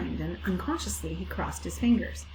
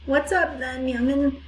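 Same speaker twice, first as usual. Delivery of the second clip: a hint of a croaky old ewe, higher; lightly voiced hoarse old ewe; croaking old ewe; 6 semitones higher, 4 dB louder than usual. A narrator's reading. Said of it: "she croaked".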